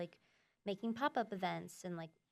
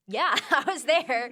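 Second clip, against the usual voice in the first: laughing through it, louder and higher; boss laughing; 6 semitones higher, 9 dB louder, slower than usual. Retold or imitated. Quoting the boss admitting he was there, laughing. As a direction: chuckle out the admission.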